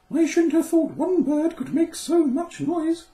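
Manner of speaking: very high, loud, chirpy bird voice; squeaky bird voice; twittering little bird. A narrator's reading. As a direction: twitter like a tiny cheerful bird. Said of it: "he twittered".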